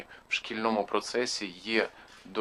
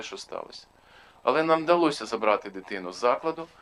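ordinary delivery

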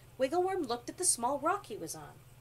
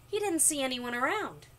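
usual speaking voice

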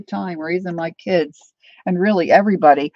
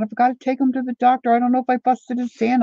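higher, hurried retelling of an upset mother; retold tearful panic; 6 semitones higher, faster than usual. Mother telling her girlfriend about the hurt hand, retold tearful panic. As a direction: quickly retell a panicked plea, pitched up. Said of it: "and I'm crying".

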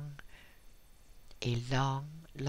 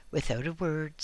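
ordinary delivery, nothing marked

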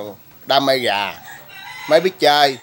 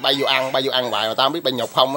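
ordinary talk

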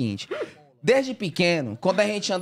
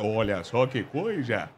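much lower, slow, softer show-host voice; lower, slow, hammed-up game-show host; Silvio Santos impression; 11 semitones lower, 5 dB quieter, slower than usual. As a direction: channel the TV host greeting a guest. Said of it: "incorporei na mesma hora".

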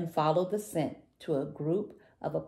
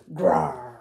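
short storybook "grawr"; louder, growled roar; grumpy dinosaur growl; 5 dB louder than usual. A narrator's reading. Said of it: "grumpiest little dinosaur ever".

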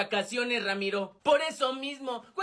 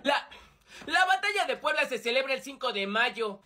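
ordinary talk

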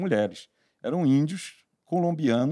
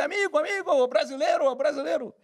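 excited chief's son; 13 semitones higher than usual. Retold or imitated. Chief's son excited over shirt.

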